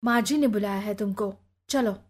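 ordinary delivery, nothing marked

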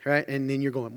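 ordinary talk

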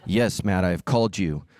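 deep, booming mock-divine voice; voice of the Lord. The Lord calling the preacher to the alligator, mock-deep divine voice. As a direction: intone it low and grand, like God calling. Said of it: "hear from the Lord".

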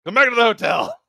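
put-on loud, high cranky old man; grumpy old man. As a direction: bark it like a gruff grandpa. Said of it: "I just want a cranky old man voice".

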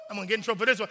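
ordinary talk, nothing marked

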